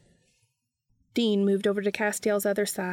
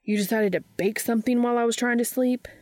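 fast, higher-pitched exasperated question; incredulous complaint, pitched up and quick; 4 semitones higher, 4 dB louder, faster than usual. A narrator's reading. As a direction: rattle it off in annoyed disbelief.

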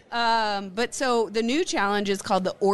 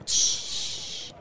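soft ASMR shush; whispered shush; 4 dB quieter than usual. Shown as a demonstration. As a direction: whisper the shush, breathy and close. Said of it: "A S M R".

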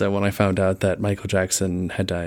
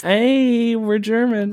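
excited; 11 semitones higher, much slower than usual. Real, not acted. Excited high exclamation.

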